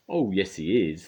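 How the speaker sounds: loud, firm reply; the crowd defending their hero; crowd shout. A narrator's reading. Crowd shouting back at Little Miss Trouble. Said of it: "they all shouted".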